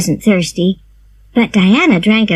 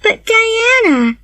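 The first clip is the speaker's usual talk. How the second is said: put-on high, slow sad plea from a girl; sad cry; 14 semitones higher, slower than usual. A narrator's reading. Anne to Diana, slow high sad plea. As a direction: Anne pleading with her friend not to leave. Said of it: "cried Anne sadly".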